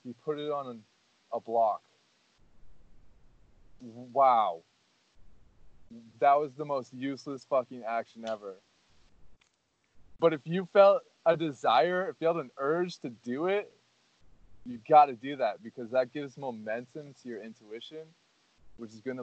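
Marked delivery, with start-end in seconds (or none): 3.8-4.6 sarcastic loud wow; mock wow. Sarcastic wow at his own pointless example. Mock awe at useless action. 10.2-15.6 louder, pressing the point hard; emphatic. Real, not acted. Urging his friend to act on every urge, emphatic.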